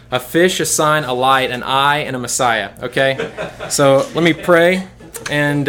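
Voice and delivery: normal talking voice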